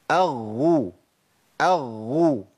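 أغو pressed with the breath held back; correct shadda ghayn. Shown as a demonstration. Teacher shows the correct doubled ghayn with damma. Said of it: "شايفين أنا بعمل إيه".

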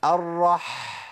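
الرح with long breathy friction at the end; mid-throat ح. Shown as a demonstration. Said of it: "حط صباعك هنا كده في منتصف الحلق".

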